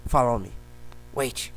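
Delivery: lightly imitated video game voice commands; short isolated orders, like game sound clips; game character commands. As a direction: mimic a game's stock commands. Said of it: "Quem conhece esse jogo?".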